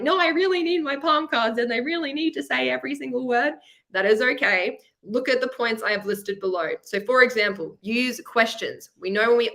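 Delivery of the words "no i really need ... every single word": a pleading, higher shy student voice. Retold or imitated. Teacher mimics a shy student begging for palm cards. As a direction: play the nervous student clinging to cards. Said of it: "you're like".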